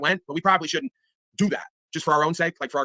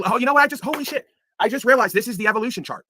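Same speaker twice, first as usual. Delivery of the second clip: surprised; 7 semitones higher than usual. Real, not acted. Sudden realization mid-rant.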